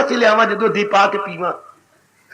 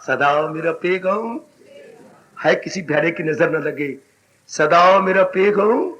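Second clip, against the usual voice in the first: slow, deliberate and low; slowly; 5 semitones lower than usual. Shown as a demonstration. Preacher slowing down to stress the Lord's message. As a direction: draw out each word of the message. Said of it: "इसलिए मैं धीरे धीरे बोल रहा हूं".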